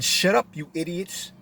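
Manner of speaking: ordinary delivery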